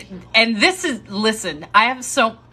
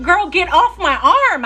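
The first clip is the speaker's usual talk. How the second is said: louder, higher exasperated plea; 6 semitones higher, 5 dB louder than usual. Retold or imitated. Carrying the sobbing colleague down the hall, exasperated raised plea. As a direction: shove the words out, fed up.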